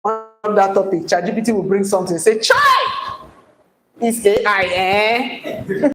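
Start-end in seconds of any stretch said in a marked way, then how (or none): 2.4-5.9 mock astonishment at the machine; amazed exclamation. Student marvelling at ChatGPT's answer, mock amazement.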